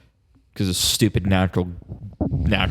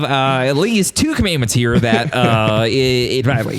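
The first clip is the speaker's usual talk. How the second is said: higher-pitched pundit impression, stammering; Ben Shapiro; 5 semitones higher than usual. Co-host citing commandments against natural energy, higher-pitched stammering pundit impression. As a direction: stumble through it in a raised, insistent voice. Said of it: "it was pretty Ben Shapiro impression".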